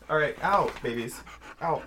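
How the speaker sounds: firm calls to get the dogs out of the room; calling out. Real, not acted.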